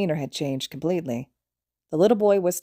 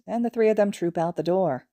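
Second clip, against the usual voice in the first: plain reading voice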